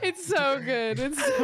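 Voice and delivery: high, laughing words near a shout; laughing hard. Real, not acted. Friend cracking up at the badge story, near shouting. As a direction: squeal it out through the laughter. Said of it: "show it".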